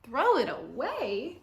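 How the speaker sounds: horrified echo. Acted. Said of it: "Grandma looked horrified".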